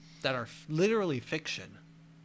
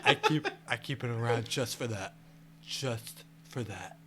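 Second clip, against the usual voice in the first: Trump impression.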